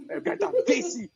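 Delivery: high put-on voice of his angry dad; dad calling him. Imitating his father summoning him for trouble, high and stern. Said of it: "back when I was a kid".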